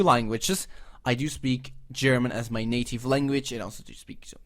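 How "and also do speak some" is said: a self-deprecating whispered aside; mock whisper. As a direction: whisper it like a little confession. Joking aside about his English.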